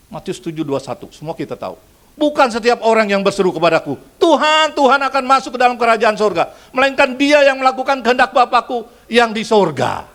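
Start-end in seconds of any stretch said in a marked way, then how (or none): 2.2-10.1 high, loud preached scripture; fiery sermon delivery; preaching at full force. Acted. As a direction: proclaim it to the whole hall.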